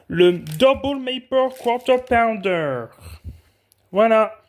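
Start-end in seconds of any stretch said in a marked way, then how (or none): 0.1-2.8 slow, high product reveal; showy announcer. Pretend. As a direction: announce it grandly, stretching each word. Announces the burger's English name.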